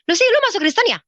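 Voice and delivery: high, loud nosy demand; accusing question. Retold or imitated. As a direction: imitate a friend's startled interrogation. Friend confronts her with a question.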